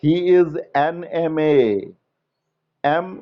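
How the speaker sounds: very slow, each word apart: "he is an M A"; teacher spelling out the answer slowly; slow dictation. Shown as a demonstration. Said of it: "बिल्कुल सही कह रहे हैं".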